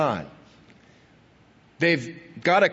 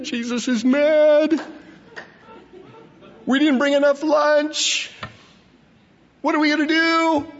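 panicky disciples; 7 semitones higher than usual. Retold or imitated.

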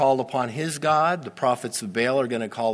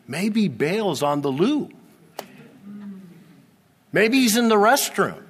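mocking jab; 7 semitones higher than usual. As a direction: land the insult with a grin. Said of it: "Elijah mocked them".

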